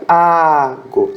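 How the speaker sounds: "Ago" with a long, stressed A; stress on first syllable. Shown as a demonstration. Teacher showing the tonic syllable of the verb, first A of "Ago" stressed. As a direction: hold the A of "Ago", light ending. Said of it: "qual que é a sílaba tônica?".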